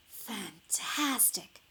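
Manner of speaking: slow breathy wonder; awed praise. A narrator's reading. Elizabeth praises the dragon's fire, slow hushed wonder. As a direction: marvel at it slowly, breathing out.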